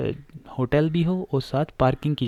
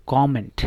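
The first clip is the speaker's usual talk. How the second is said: Comment as a noun, COM-ment, first part louder; stress on first syllable; 5 dB louder, slower than usual. Shown as a demonstration. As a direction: punch the first half of comment. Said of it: "पहले पे स्ट्र- stress है".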